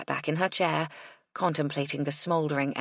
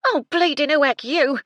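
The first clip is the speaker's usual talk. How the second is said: much higher, much louder furious yell; 11 semitones higher, 8 dB louder than usual. A narrator's reading. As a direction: scream at the startled girl. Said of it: "Gertie yelled".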